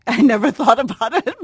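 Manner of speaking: laughing through the words; amused at herself, laughing aloud. Real, not acted. Author laughs at her own remark to the interviewer, laughing.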